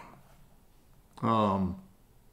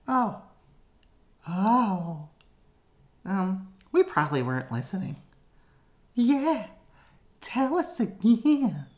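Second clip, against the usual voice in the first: very high sheepish voice; squeaky kids; 9 semitones higher than usual. Acted. Kids answer the villain.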